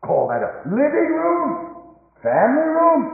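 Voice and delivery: high, loud scornful question; indignant preacher mocking the family room; incredulous preaching cry. Real, not acted. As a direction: scoff at it, full voice. Mocking modern family life.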